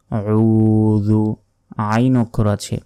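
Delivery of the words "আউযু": slow throaty আউযু; ain from the throat. Shown as a demonstration. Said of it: "আইনের সঠিক উচ্চারণ".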